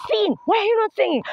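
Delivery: high, insistent mock scolding; scolding director. Director to the actor who is not singing, high scolding. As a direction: demand it like an exasperated director.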